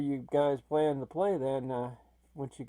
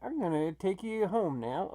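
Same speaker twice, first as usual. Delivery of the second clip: ordinary delivery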